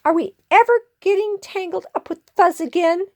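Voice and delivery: high, quick squeaky question; rally the crowd in a tiny voice; crying out. Acted. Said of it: "cried Pip Squeak".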